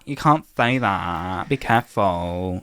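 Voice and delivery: very slow, drawn-out mock warning; mocking the offended. Mimicking people who just get easily offended, mocking. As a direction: stretch each word out like a silly scold.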